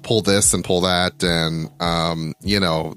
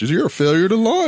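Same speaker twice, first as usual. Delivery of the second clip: dad's voice; 13 semitones higher, 3 dB louder than usual. Retold or imitated. Dad's voice quoted, mocking.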